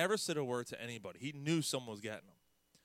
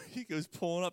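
ordinary talk, nothing marked

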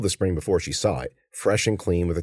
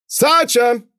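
loud, high holler; calling the dog; 7 dB louder than usual. Acted. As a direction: shout the name into the woods. Man calls his dog.

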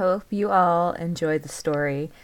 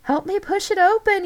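very high, loud yell; 8 dB louder than usual. A narrator's reading. Boy to friends at the jammed gate, urgent yell. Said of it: "yelled Michael".

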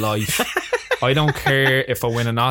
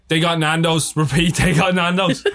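raised, mock-urgent radio call; walkie-talkie announcement; 5 semitones higher, 3 dB louder than usual. Crew member radioing the news that food arrived, mock walkie-talkie announcement. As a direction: imitate an excited radio report, pitched up. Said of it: "all I heard on the walkie-talkie".

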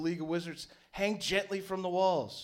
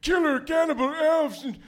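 put-on high, loud furious old man; angry old wizard; 10 semitones higher, 8 dB louder than usual. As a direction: rant like an enraged old wizard. Wizard rages at Agonic.

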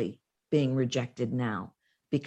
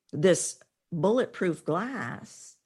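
ordinary talk, nothing marked

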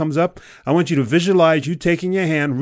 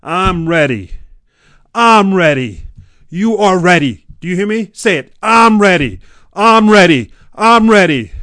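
loud, high shouted battle cry; rallying declaration; 6 semitones higher, 10 dB louder than usual. Acted. Coach leading listener to declare readiness, fired-up shouting. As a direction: shout it out, growing louder with each repeat.

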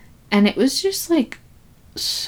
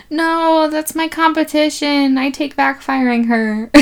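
high, loud, hammed-up objection; mock protest; 9 semitones higher, 4 dB louder than usual. Yardley objecting to losing her to his competition, mock protest. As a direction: play a boss wailing in mock dismay. Acted boss panicking over rival.

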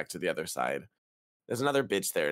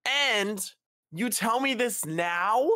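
high, loud, slow outraged question; livid; 12 semitones higher, 4 dB louder, much slower than usual. Retold or imitated. Traveller livid at agent's late news, shrill outrage. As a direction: demand it in disbelief. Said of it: "So I was livid".

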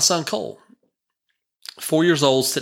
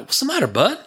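gentle concern; 11 semitones higher than usual. Retold or imitated. Father asking his tearful son what's wrong, gentle and high. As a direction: coax it out of him gently.

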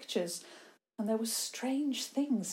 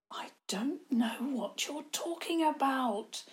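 slow, nervous near-whisper; frightened boy whispering his denial; 6 semitones higher than usual. Acted. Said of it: "Theo almost whispered".

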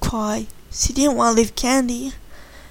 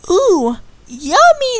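put-on slow, high, childlike ooh; drawn out like hungry little kids; kids' voice; 8 semitones higher, slower than usual. A narrator's reading. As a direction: orphans delighted by barbecue food, slow and high. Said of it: "the kids answered".

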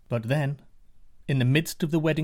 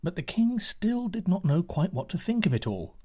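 ordinary delivery, nothing marked

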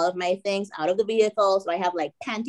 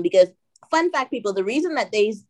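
ordinary delivery

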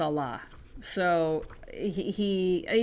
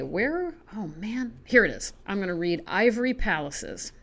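normal talking voice

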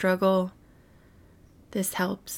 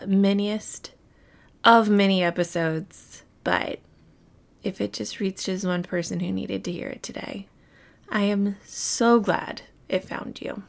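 ordinary talk, nothing marked

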